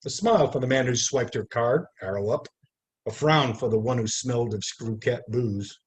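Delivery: measured, lyrical poetry reading; poem reading. Acted. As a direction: read it like a poet at an evening reading. Reads poem aloud at reading.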